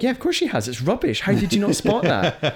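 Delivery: high, mock-exasperated reply; incredulous retort. Telling the film character the accent was plain, mock-exasperated. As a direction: scoff as if it were obvious. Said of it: "I was like".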